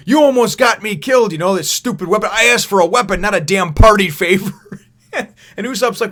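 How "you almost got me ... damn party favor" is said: high, loud mock scolding; angry Nami. Nami telling off Usopp in a high, loud mock rage. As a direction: chew him out over the useless weapon. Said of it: "she's like".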